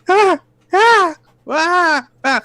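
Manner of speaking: very high, short repeated shrieks; shrieking patrons. Acted. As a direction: squeal in alarm, one patron after another. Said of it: "eking, shrieking at the".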